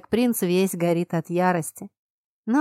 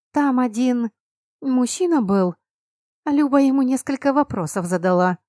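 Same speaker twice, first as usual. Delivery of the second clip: ordinary delivery, nothing marked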